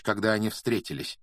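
usual speaking voice

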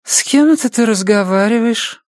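high-pitched, drowsy female character voice; sleepy high voice; sleepy woman. A narrator's reading. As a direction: ask it drowsily, pitched well up. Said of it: "сонно спросила".

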